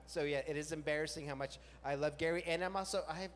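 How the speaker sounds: ordinary talk, nothing marked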